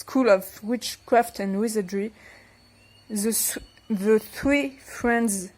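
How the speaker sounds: halting English in a French accent; attempted English accent. Real, not acted. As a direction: read English slowly through a French accent. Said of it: "avec un accent anglais".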